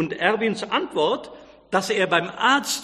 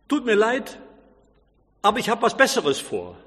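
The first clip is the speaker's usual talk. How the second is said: curt; 2 semitones higher than usual. A narrator's reading. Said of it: "er sagte ziemlich barsch".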